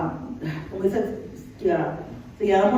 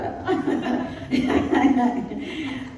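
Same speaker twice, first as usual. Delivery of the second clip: laughing; 3 semitones higher, 3 dB quieter than usual. Real, not acted. Preacher amused by own acting, laughing. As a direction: chuckle after your own performance.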